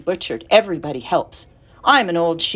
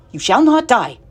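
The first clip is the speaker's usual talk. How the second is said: firm, raised-pitch reassurance; louder, no-nonsense declaration; brisk; 5 semitones higher, 5 dB louder than usual. Acted. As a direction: cut in firmly and briskly. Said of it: "said Charlotte briskly".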